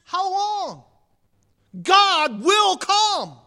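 preacher's closing climax; fiery proclamation. Acted. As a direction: thunder it to the end. Preaching at full force.

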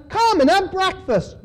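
high, inviting call; calling invitation. A narrator's reading. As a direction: call them over, pitched well up. Jesus calling disciples, read aloud.

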